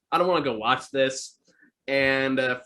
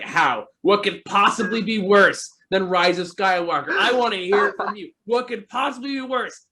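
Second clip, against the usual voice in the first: rising, louder indignant disbelief; mock outraged friend; 7 semitones higher, 3 dB louder than usual. Friend demanding the worst movie, mock outraged. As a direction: demand an answer, getting higher each line. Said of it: "he was mad".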